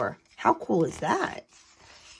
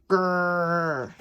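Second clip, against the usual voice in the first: a small child's pretend growl; playful dinosaur growl. George plays a dinosaur.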